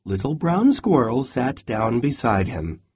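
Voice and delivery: usual speaking voice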